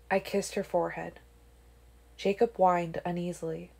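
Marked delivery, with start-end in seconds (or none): none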